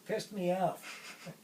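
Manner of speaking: drawn out with a wry edge; pointed phrase. Real, not acted. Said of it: "how can I put this tactfully?".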